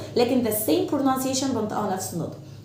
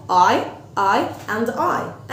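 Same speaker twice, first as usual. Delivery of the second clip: "I and I" stretched very slowly; slow demo; much slower than usual. Shown as a demonstration. Teacher modelling eye and I for kids. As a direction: space out "I and I" slowly. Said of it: "I يعني عين واحدة".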